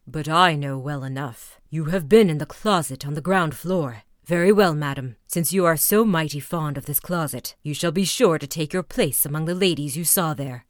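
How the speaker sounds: low, swelling threat of death; menacing Bluebeard. A narrator's reading. Husband condemning his wife to die, low and loud.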